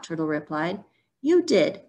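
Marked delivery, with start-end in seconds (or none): 1.2-1.8 high, insistent accusation; heated retort. A narrator's reading. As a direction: fire back in a storybook quarrel. Acted quarrel after being cheated.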